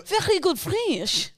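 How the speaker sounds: slow, high mock French accent. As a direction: drag it out in hammed-up French. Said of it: "You're great at French".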